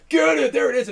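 high, loud mock mob yell; mob shout. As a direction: yell like an angry crowd. Mob yelling at the suspect.

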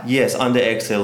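ordinary delivery

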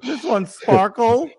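high, drawn-out mock doting voice; crazy cat owner. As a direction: present a beloved cat in a squeaky coo. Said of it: "like every other fucking crazy cat owner".

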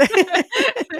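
bright high laughter; laughing at herself. Real, not acted.

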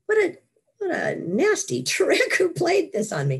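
exasperated outcry. Retold or imitated. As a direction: throw up your hands at a cosmic prank. Recalling trapped after coma.

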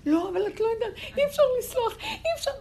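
put-on high, loud childish protest with a laugh; imitating an upset child. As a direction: whine that it can't be forgiven.

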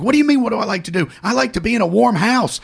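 high, fast, worked-up retort; retold indignant yelling. Woman without heat answering Mike, indignant yelling. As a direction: act out her yelling back. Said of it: "stop yelling at me".

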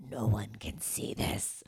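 low, slow secretive whisper. Retold or imitated. Imitating herself hiding her love, whispered. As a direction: hide it under your breath.

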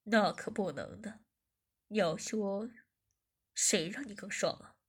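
low, slow, teasing boss voice; amused teasing. A narrator's reading. Boss teases Zhiming, slow and low. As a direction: savour the tease, ending in a hush. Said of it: "我笑着说".

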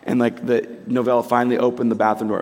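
ordinary talk, nothing marked

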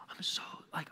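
softly choked, voiceless fake sob; hushed pretend weeping; mock crying. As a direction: barely get the apology out, as if crying. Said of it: "just starts crying".

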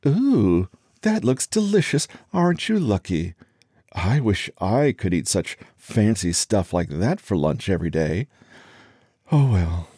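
high-pitched sugary condescension; fake-sweet envious coo; condescending. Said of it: "in a high-pitched, condescending voice".

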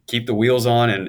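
laughing through a retold order; laughing mock instruction to the paralegal.

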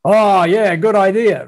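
mock-enthusiastic Aussie bloke. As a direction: play the blokey Aussie agreeing too readily. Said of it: "the Aussie bloke in me said".

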